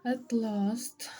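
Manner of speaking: usual speaking voice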